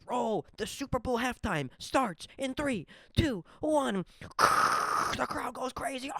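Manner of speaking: hammed-up Super Bowl countdown; mock announcer.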